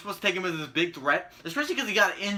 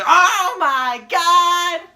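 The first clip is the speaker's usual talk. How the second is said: hammed-up announcer shout, much higher, louder and very slow; Michael Cole shock; 11 semitones higher, 12 dB louder, much slower than usual. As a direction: stretch it out like a stunned commentator. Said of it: "Michael Cole goes".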